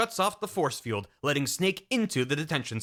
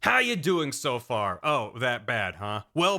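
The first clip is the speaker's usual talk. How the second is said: ordinary talk, nothing marked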